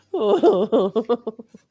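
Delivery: laughing with a catch of crying; relieved laughter. Real, not acted. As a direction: laugh out the tension. Laughing after the win.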